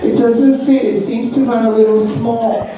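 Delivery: lightly voiced shopper, feeling out an excuse; hesitant customer. Woman squeezing into too-small shoe, high hesitant complaint. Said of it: "And she'd be like".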